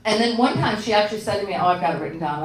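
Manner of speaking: usual speaking voice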